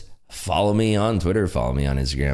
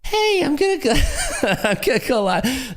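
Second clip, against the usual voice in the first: much higher put-on voice, breaking into laughter; Hogue impression. Streamer imitating a fellow trader waking up, laughing mock impression. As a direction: mimic a friend's distinctive voice, cracking up midway. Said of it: "That's his voice".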